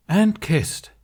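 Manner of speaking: loud, high mock proclamation; teasing declaration. A narrator's reading. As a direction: announce it to provoke him. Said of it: "he declared".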